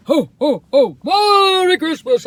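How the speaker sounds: loud high Santa ho ho ho; big slow Santa greeting; jolly ho ho ho. Shown as a demonstration. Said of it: "That's how I do it".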